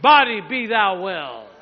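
drawn-out, loud command of healing. Real, not acted. Preacher to the congregation, commanding the body be well, loud and commanding. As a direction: proclaim it slowly and forcefully.

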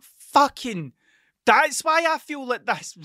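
high, loud and slow; dawning realization. Retold or imitated. Realizing the shots explain how he feels, high loud slow. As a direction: let the penny drop out loud.

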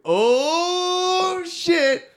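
long, high drawn-out yell; big whooping holler; excited shout. Real, not acted. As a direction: wail it out high and very loud. Excited reaction to the Italy clue.

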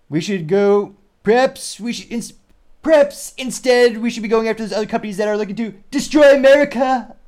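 high, loud put-on presidential voice, hammed up; Trump impression. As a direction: ham up a big brash politician, pitched high and loud. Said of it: "maybe I will attempt his dialect".

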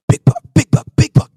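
breathless whispered big buck; mock panic whisper; excited whisper. Friend retells the hunter spotting the buck, whispered. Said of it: "you were like".

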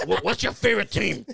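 exaggerated smoker's rasp; raspy voice. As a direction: imitate a raspy chain-smoking salesman. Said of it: "super raspy".

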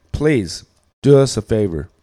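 ordinary talk, nothing marked

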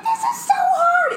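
very high, loud, drawn-out yell; retold screaming. Fan reacting to new [name] songs, retold screaming. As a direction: shriek it like an overexcited fan. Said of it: "she was screaming".

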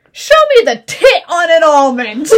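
high, loud laughing shout; quoting Ashton Kutcher. Retold or imitated. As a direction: belt it out through laughter. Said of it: "He's like".

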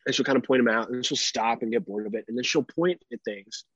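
ordinary delivery

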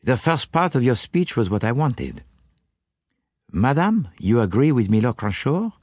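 put-on French-accented detective with rising questions; Poirot questioning. Detective asks madame if she agrees, questioning. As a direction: press politely in a French accent.

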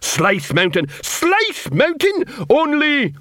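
outraged disbelief, climbing very high; scornful troll sergeant. Acted. Sergeant mocks recruit's home mountain, scornful and high.

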